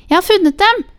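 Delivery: high, loud cry of discovery; excited child's cry. A narrator's reading. Child cries out to grandmother that the dentures are found, shouting high. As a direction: call it out high and triumphant as a child. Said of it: "skrek barnet og reiste seg".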